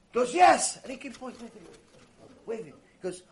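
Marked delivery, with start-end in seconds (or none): 0.3-0.8 put-on high, loud childlike certainty; little boy's insistence. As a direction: answer as a sure little boy, pitched up. Boy insists captain will wave.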